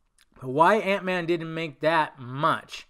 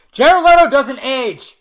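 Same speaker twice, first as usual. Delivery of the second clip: high, very loud, drawn-out yell; exclaimed shout; 10 semitones higher, 17 dB louder, slower than usual. Acted. Host exclaiming to viewers about an ageless actor, loud high yell. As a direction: shout it out in exaggerated amazement.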